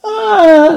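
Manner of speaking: sharp high cry of agony; high pained cry. Acted. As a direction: cry out as the body twists. Clarence transforms, cries out.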